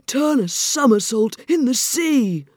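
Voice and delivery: high, loud, slow excited cry; capering creature's loud holler; Mock Turtle's cry. A narrator's reading. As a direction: cry out wildly, stretching the words. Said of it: "cried the Mock Turtle".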